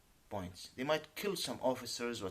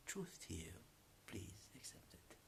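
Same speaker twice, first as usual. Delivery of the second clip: whisper; 3 semitones lower, 16 dB quieter than usual. Real, not acted. Quiet plea to the viewers.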